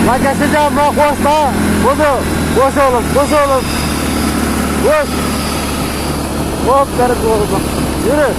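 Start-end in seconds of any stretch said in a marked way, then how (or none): 0.1-7.3 high, breathless calls to the dog, laughing between; racing the dog through the snow, shouting after him; excited calling while running. Real, not acted. Vlogger racing his dog Bozo through snow, excited high calls.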